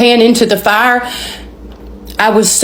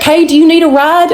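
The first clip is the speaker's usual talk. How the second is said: high-pitched imitation of a helpful driver; calling out an offer; 8 semitones higher than usual. Girl driver to a stranded stranger, calling out an offer. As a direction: call it over lightly, pitched up.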